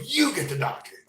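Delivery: high, loud barked order; commanding shout. Retold or imitated. Burned man ordering a bystander to fetch the doctor, loud shout. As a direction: point at him and command.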